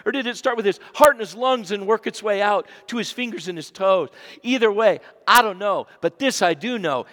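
full-volume sermon delivery; preaching at full force. Acted. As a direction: preach it big to the congregation.

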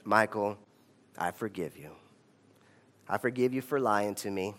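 soft, low, tender teacher's voice; gentle forgiveness. Retold or imitated. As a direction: forgive him quietly and kindly.

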